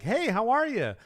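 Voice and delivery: high, animated friendly greeting; lively high-pitched hello. Acted. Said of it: "But I'm pretty animated".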